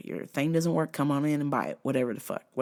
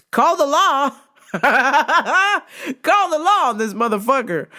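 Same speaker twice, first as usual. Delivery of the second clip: call the law; 9 semitones higher, 7 dB louder, slower than usual. Acted. Outraged comic punchline.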